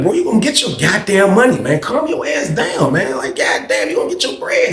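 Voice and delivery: calm down, man, said high and strained; exasperated high reassurance. Retold or imitated. Calming down angry Jason.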